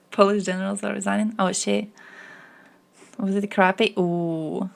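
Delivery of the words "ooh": normal talking voice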